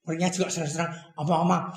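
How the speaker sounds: ordinary delivery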